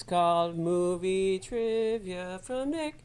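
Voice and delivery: slow, grand announcer voice. Acted. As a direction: announce the segment title. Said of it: "Here's a new segment".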